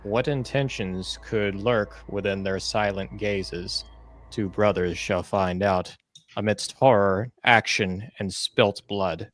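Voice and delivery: slow dramatic trailer voice; trailer narration. Acted. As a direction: build menace like a movie trailer. Intros his trailer.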